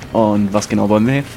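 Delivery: ordinary delivery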